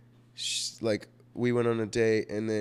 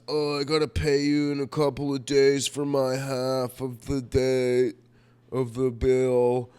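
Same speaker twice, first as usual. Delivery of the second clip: drawn-out mock apology, a bit higher and louder; mock apologetic excuse; 3 semitones higher, 5 dB louder, slower than usual. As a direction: stretch the excuse out slowly like a sheepish guy. Retelling own excuse to date.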